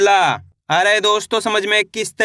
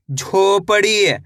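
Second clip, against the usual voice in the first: slow blend; much slower than usual. Shown as a demonstration. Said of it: "teacher blends syllables slowly".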